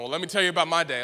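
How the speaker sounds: mock over-eager interruption; interrupting friend. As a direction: cut in like a self-absorbed friend.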